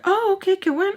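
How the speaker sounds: high-pitched mock phone reply; imitated eager caller. Voicing Mister Peach on phone.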